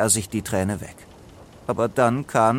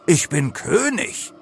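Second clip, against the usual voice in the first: higher-pitched, outraged declaration; indignant; 5 semitones higher than usual. A narrator's reading. Leo answers the animals' insult, indignant. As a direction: assert the royal rank, affronted. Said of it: "Leo schnappte nach Luft".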